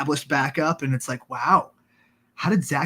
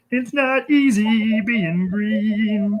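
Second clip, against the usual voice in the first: slow, high-pitched frog puppet voice; sing-song like a felt frog; Kermit the Frog voice; 5 semitones higher, much slower than usual. Acted. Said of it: "do a Kermit the Frog impression".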